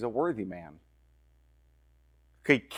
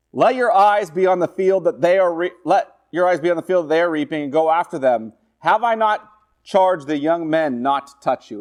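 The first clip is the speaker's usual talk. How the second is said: louder, raised reading voice; scripture reading; 4 semitones higher, 5 dB louder than usual. Acted. Preacher reading Boaz's charge to Ruth aloud. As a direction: proclaim the verse.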